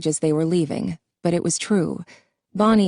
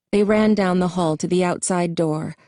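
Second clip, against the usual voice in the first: plain reading voice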